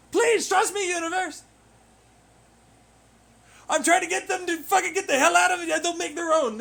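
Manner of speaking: very loud, high desperate scream; panicked screaming. Acted. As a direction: scream for your life at the top of your voice. Acting out a doomed mind's panic.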